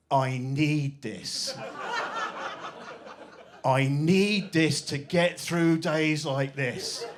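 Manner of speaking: very slow, heavy, world-weary drawl; weary teen plea. Retold or imitated. Vaping nephew to aunt, slow weary drama. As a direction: sigh it out like a burdened martyr. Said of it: "he just stood there and he went".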